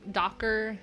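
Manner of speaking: normal talking voice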